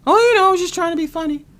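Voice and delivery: high, quick, loud mimicry; mock excuse. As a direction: imitate a coworker brushing it off. Mimics coworker's feeble excuse.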